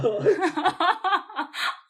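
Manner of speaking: loud high laughing fit; burst of laughter. Real, not acted. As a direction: laugh at the blunder. Laugh after the confession.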